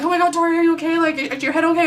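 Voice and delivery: worried friends. Retold or imitated. Friends worried about her head.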